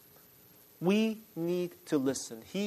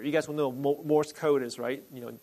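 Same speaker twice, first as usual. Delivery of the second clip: normal talking voice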